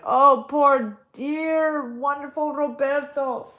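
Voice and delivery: high, loud grieving cry. Acted. Grandmother grieving her lost Roberto, crying out. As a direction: wail his name in grief. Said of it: "she cried".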